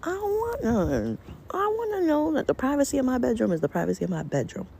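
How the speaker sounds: high, emphatic protest; indignant. Real, not acted. Insisting on bedroom privacy.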